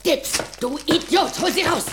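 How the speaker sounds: angry shout. Acted. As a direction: yell at the fool to fetch it. Orders lawyer to grab the deed.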